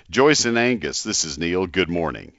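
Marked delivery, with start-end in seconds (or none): none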